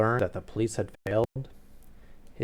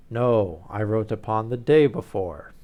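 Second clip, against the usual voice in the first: a louder, booming nobleman; booming Duke; 10 dB louder than usual. Acted. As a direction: let the Duke's words boom out like a dinner gong. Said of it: "I was already using my deep voice".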